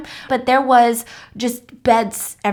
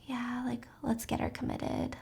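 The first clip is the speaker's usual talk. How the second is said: soft conspiratorial whisper; hushed family plotting; whispered scheming; 17 dB quieter, slower than usual. Retold or imitated. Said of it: "family members or friends who are like".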